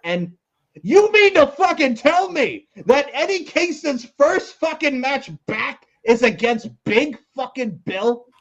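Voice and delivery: ordinary talk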